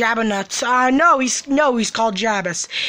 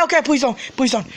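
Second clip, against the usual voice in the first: normal talking voice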